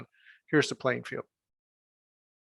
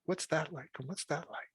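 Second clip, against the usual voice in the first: curious; 3 semitones higher, 11 dB quieter than usual. Retold or imitated. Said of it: "we're like".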